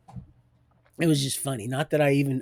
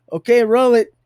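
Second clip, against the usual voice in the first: loud, higher "Okay, roll it"; loud cue; 6 semitones higher, 8 dB louder than usual. Retold or imitated. Director calling out loudly to start the recording. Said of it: "And she said".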